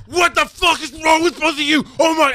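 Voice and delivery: put-on high, loud exploding yell at the kids; a dad who has snapped, screaming; furious outburst. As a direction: blow up at them, voice cracking high. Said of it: "he goes fucking off on them".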